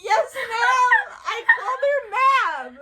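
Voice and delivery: high, loud flustered shout; loud, laughing, mock-mortified cry; starstruck outburst. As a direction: squeal it like an overwhelmed fan. Starstruck reply acted out.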